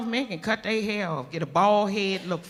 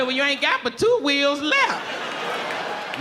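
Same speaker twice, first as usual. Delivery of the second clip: slow teasing; 3 semitones higher, much slower than usual. Retold or imitated. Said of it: "I said".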